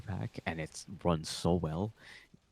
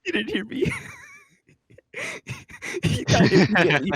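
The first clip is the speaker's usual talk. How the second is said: laughing; 10 dB louder than usual. Real, not acted. Laughing while retelling the clip.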